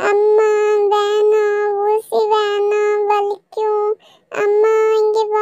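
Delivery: high, slow, tearful wail for mum; child sobbing, begging to skip the shot; crying child. Acted. Rahul cries to his mother before the injection, high tearful wail. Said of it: "ராகுல், அழுவக்கூடாது".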